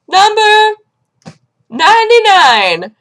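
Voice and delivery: high, loud, drawn-out number call. Retold or imitated. Clinic worker calls next number, loud announcement. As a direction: project it across a waiting room.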